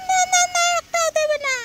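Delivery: put-on very high little-kid voice; child voice. Child complaining to uncle about lychees, high child voice.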